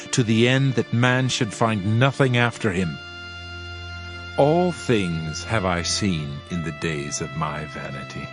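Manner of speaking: ordinary delivery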